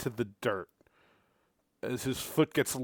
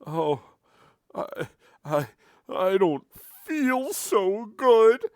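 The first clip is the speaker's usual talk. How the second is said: higher, slow, sick voice; nauseous high voice; queasy merchant; 9 semitones higher, 6 dB louder, slower than usual. Acted. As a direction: groan like you're about to retch. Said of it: "he goes".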